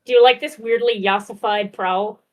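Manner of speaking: usual speaking voice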